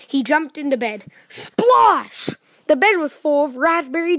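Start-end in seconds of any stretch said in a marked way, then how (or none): 1.3-2.4 high, swooping "splosh"; splash exclamation. A narrator's reading. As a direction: exclaim it like a big sudden splash. Sound of landing in jam.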